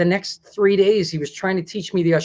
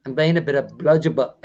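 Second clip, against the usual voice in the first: lower, put-on Aussie voice; attempted Australian accent; 4 semitones lower than usual. Shown as a demonstration. Host repeating his tutor's phrase, attempted Australian accent. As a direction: copy the Australian slang, low. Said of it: "what was the last one?".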